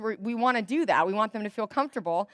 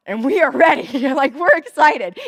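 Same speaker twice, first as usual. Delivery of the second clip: louder, thrilled we're ready; excited welcome; 3 semitones higher, 9 dB louder than usual. Acted. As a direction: burst out with eager welcome. Voicing church welcoming parents.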